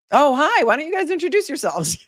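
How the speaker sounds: bright, high, overly cheerful dodge; mock-breezy high hello to hide panic; awkward fake cheer. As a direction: cover a forgotten name with high forced cheer. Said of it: "and then, uh".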